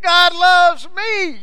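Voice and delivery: high, loud, drawn-out joyful yell; child yelling. Retold or imitated. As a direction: shout it out like a delighted little girl. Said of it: "they can hear her yelling".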